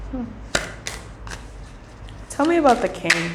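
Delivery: usual speaking voice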